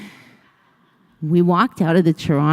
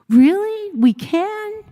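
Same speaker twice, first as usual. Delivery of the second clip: put-on excited girl; 13 semitones higher, 3 dB louder, slower than usual. Girls thrilled by the offer.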